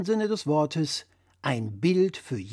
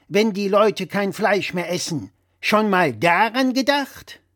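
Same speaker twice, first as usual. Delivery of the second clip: louder, higher know-it-all dad voice; blustering father; 6 semitones higher, 6 dB louder than usual. Acted. Father lecturing his son over the phone, louder and higher. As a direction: play the pompous father correcting his son. Father rants about real men.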